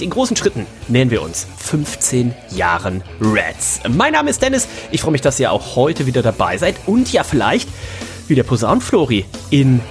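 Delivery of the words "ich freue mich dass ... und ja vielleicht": ordinary talk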